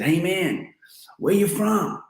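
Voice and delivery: a pushy photo organiser calling out; American organiser. Retold or imitated.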